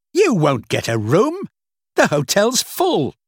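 loud, high, irritated retort; angry outburst. A narrator's reading. Angry guest to Rachel at the hotel, loud and cross. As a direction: tell them off loudly.

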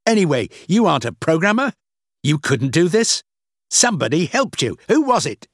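high, cross interrogation; angry accusation. A narrator's reading. Boss questioning Rachel about her helper, angry. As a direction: accuse her, then demand a name.

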